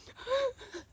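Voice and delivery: sharp high intake of breath; imitated tearful gasp. Sister says suck it in, mock gasp to stop crying. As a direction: gasp as if sucking tears back. Re-enacting sucking in her tears.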